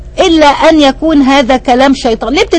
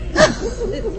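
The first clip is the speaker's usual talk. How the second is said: short laugh; 9 semitones higher, 10 dB quieter than usual. Real, not acted. Laughs at her own joke.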